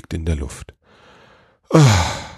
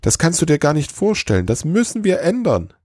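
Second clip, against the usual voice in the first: much higher, eager elf voice; still excited; 11 semitones higher than usual. A narrator's reading. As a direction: push it up with excitement. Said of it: "immer noch aufgeregt".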